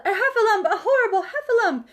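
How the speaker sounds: high, loud frightened squeal; panicked cry. A narrator's reading. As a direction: squeal it out in terror. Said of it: "cried Piglet".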